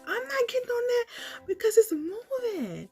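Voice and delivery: high, nervous refusal; scared refusal. Retold or imitated. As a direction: back away from the bridge, scared. Refusing moving bridge.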